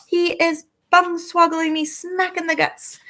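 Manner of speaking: shouted. A narrator's reading. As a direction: bellow the complaint. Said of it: "shouted the gizzard gulper".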